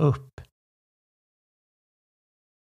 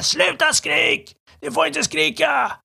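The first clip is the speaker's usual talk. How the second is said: shouting back. Retold or imitated. Father yelling back at his shouting child. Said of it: "och jag skriker tillbaka".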